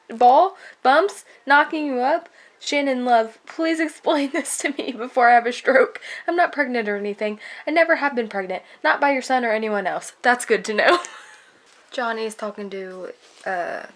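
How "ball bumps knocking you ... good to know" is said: loud, brisk acted dialogue; mock alarmed disbelief. Reader acts out mom and Shannon's pregnancy mix-up, flustered. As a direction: rattle off the startled questions and denials loudly. Acting out alarmed misunderstanding from book.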